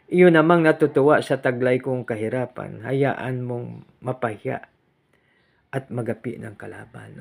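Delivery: loud, measured reading of a psalm verse; solemn scripture reading. Acted. Reads psalm verse aloud. (mabagal at malakas na pagbasa ng salmo)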